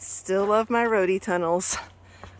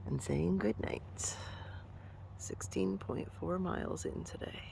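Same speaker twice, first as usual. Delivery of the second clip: whispering; 16 dB quieter, slower than usual. Real, not acted. Tent at night, whispering.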